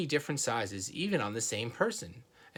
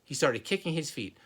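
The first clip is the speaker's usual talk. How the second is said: usual speaking voice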